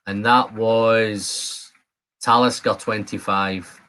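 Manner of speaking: ordinary talk, nothing marked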